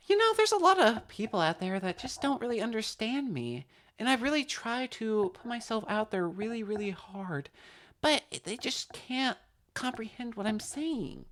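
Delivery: high, soft put-on woman's voice; female voice. Confiding that people don't understand, put-on female voice. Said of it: "my female accent is also not great".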